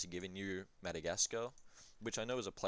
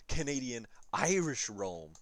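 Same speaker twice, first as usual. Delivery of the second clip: slow, louder grand offer; hammed-up pitch; 3 semitones higher, 5 dB louder, slower than usual. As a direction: sell it like a prize. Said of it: "now we speak in gibberish".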